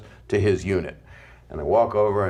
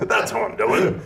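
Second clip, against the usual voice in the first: retold yell; 10 semitones higher, slower than usual. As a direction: bark it out like an old comic finally snapping. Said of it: "He yelled it".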